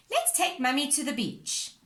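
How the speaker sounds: usual speaking voice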